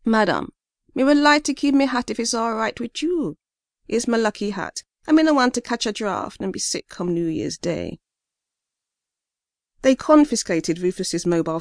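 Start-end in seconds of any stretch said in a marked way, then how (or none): 0.0-8.0 polite Caribbean man, pleading; Caribbean accent. Acted. Rufus pleads with police officer.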